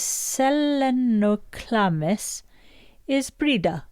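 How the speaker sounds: put-on slow, drawn-out Welsh accent. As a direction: say the Latin name like a Welsh speaker. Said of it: "Why did you say that with the Welsh?".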